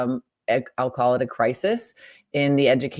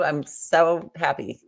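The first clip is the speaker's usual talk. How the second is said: ordinary talk, nothing marked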